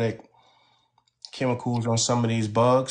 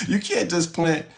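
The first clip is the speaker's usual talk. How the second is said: laughing; 9 semitones higher than usual. Real, not acted. Laughs while repeating the point.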